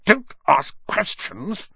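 slow, high, emphatic snap; snapping. A narrator's reading. Uncle shuts down boy's question, snapping. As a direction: snap each word separately. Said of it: "snapped his uncle".

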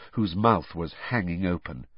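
usual speaking voice

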